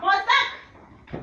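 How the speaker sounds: high, loud cry over a scuffle; loud, high protest; protesting shout. Acted. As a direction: yell it while being pushed out. Being thrown out, wants her bag.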